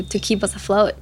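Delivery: normal talking voice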